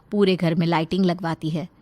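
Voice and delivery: ordinary talk, nothing marked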